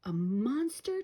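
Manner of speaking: mildly meek hesitant ask; frightened twin asking about the monster. A narrator's reading. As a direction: ask timidly. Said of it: "Tommy asked meekly".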